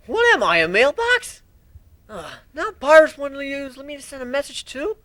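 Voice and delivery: put-on high, loud young dragon grumbling about mail; a put-upon young dragon, complaining loudly; Spike, annoyed. Spike voiced as a character.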